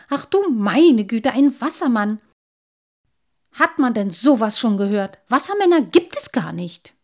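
loud, scornful exclamation; exasperated disbelief. A narrator's reading. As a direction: dismiss it as utter nonsense.

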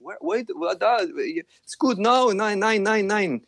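high, halting tourist voice; confused German tourist. Retold or imitated. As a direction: stammer in confusion.

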